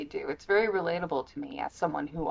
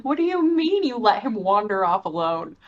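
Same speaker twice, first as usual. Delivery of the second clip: louder, higher indignant question; mock protective scold; 4 semitones higher, 7 dB louder than usual. Speaker imitating the character scolding about him wandering off, mock indignant. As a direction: scold like a worried guardian.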